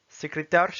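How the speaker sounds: "Secrétaire" as Russian "sekretar"; Russian pronunciation. Shown as a demonstration. Teacher reading words with learners, "Secrétaire" in Russian. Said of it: "s'entraîner à lire les mots".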